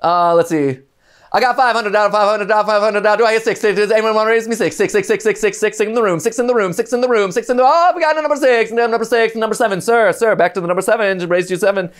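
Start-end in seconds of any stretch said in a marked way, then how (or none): 1.3-11.9 higher, fast auction patter; auctioneer. Shown as a demonstration. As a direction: rattle off bids like an auctioneer. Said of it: "Can you imitate an auctioneer?".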